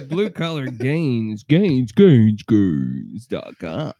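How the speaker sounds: slow, sinking echo on "gains dot com"; hammed-up sponsor echo. Hammed-up echoing sponsor read.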